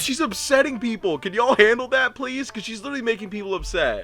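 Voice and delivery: high-pitched, insistent pleading; retold exasperation. Tech to NCP about a screaming patient, retold exasperation. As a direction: argue back as if fed up.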